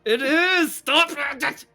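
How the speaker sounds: high, loud exasperated yell; pained protest. Real, not acted. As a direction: yell at them to quit teasing. Protests teasing about mispronunciation.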